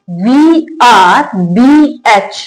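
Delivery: spelled out letter by letter, very slowly; slow letter spelling. Shown as a demonstration. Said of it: "next है word में". (अक्षर-अक्षर बहुत धीरे बोलना)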